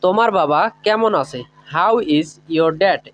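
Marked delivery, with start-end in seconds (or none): 1.7-3.0 each word spoken slowly and clearly for learners; teacher modelling a question slowly; slow, careful English. Shown as a demonstration. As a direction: lay out every word so students can copy it. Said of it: "এভাবে বলবো।".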